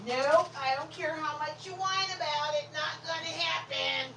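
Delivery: singsong no to a whining dog; teasing firm refusal. Real, not acted.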